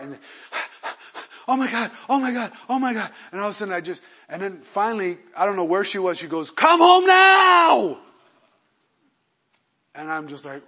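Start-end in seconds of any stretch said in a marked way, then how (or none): 0.2-1.4 imitated breathless gasps; panicked panting. Imitates wife running on phone. 1.5-3.1 high-pitched, breathless mock alarm; imitated panic. Imitates panicked wife on phone. 6.5-8.0 very high, drawn-out shriek; imitated scream. Imitates wife screaming down the phone.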